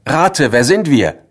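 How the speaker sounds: letters calling teasingly to the narrator. Acted. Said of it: "Sie haben gerufen".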